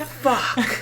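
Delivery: usual speaking voice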